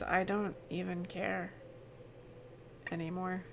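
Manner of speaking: quiet, hollow, hopeless murmur; despondent Tina. Acted. GM voicing the gray Tina to the players, quiet and hopeless. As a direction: speak as if nothing matters anymore. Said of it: "And she says".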